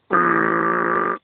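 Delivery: mock appalled urgh; disgusted groan. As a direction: imitate their shocked reaction. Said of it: "and they're like".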